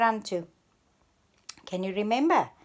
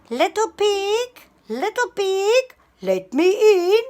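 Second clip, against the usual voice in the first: coaxing wolf; 12 semitones higher, 9 dB louder than usual. Acted. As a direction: lure the pig out in a teasing sing-song. Wolf calls at Alma's door.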